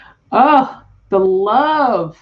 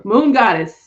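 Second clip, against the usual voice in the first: normal talking voice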